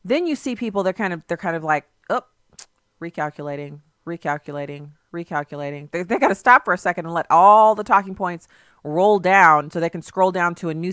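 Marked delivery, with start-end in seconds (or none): 2.1-5.9 low, soft robotic "recalculating" said three times; mock GPS voice. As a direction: play a stalled sat-nav, deadpan. Mocking people stalled like GPS.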